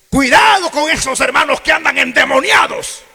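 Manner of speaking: high, loud mock warning shout; mocking shrill alarm about possessed brethren; alarmist warning. Preacher mocking alarmists, in a high loud warning shout. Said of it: "personas que dicen".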